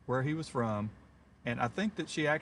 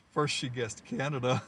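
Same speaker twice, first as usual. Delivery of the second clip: normal talking voice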